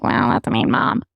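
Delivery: whispered judgment from the onlookers; onlooking parents whisper about the strict mom; judging whisper. Retold or imitated. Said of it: "parents are looking at me like".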